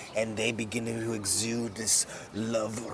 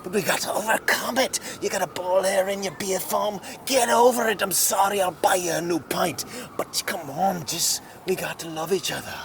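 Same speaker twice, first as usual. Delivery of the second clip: higher, louder rising plea; rally them with love; impassioned plea; 7 semitones higher, 5 dB louder than usual. Acted. Mud Dog preaches love.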